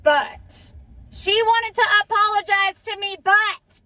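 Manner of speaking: high and loud outrage; rising indignation at the apology; loud, high indignant build; outraged. Real, not acted. Outraged emphasis retelling.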